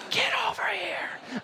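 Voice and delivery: hushed panicky whisper; urgent whisper. Retold or imitated. Urging his wife to come help, a frantic whisper. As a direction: whisper it so the woman won't hear.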